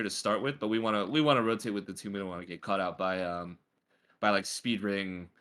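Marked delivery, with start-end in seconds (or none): none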